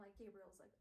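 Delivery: plain reading voice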